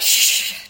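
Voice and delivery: long, loud shush; imitated husband's shush; hushing shush. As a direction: shush someone to stop them talking. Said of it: "And he often is like".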